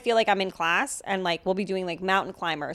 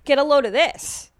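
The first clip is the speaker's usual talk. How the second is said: put-on older man's opener; 5 semitones higher, 6 dB louder than usual. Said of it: "they'll say something like".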